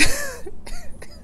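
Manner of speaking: high squealing laugh. Real, not acted. Viewer laughing at the shade, with a high squealing laugh. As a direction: burst out laughing.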